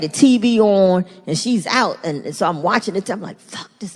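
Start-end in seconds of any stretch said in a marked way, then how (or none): 3.4-3.9 whispered. Retold or imitated. Watching secretly, muttering.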